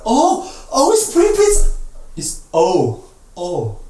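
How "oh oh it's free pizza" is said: delighted "Oh" pitched high over free pizza; round-lipped excited oh. Shown as a demonstration. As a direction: gasp with joy at free pizza. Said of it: "like you just saw free pizza".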